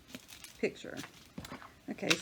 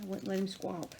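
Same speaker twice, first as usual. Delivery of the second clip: ordinary talk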